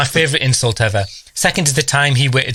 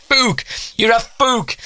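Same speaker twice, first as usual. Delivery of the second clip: put-on child's taunt; 11 semitones higher, slower than usual. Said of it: "and she's going".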